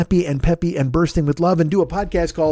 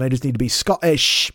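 bad Scottish accent; 3 semitones lower than usual. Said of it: "Is that Scottish?".

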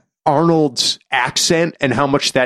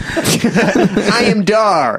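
laughing mock Dar; 5 semitones higher, much slower than usual.

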